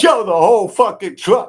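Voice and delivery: ordinary talk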